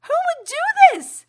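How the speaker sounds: very high, loud, slow horrified cry; mock horrified wail. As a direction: wail it in hammed-up horror. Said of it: "I'm like".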